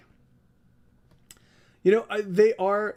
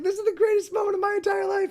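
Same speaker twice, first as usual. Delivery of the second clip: ecstatic dog. Retold or imitated. Owner voicing her puppy's wild joy at the crate, shouting high. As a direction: shriek it like pure puppy delight. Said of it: "she's like".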